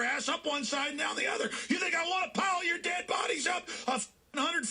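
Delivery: a radio host shouting threats at his critics; furious shout. Real, not acted. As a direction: scream it at the people you hate. Host rants at his enemies.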